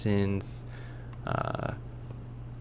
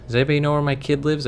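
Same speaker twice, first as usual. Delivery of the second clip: calling out; 6 semitones higher, 3 dB louder, much faster than usual. Real, not acted. Lost in game, calling out.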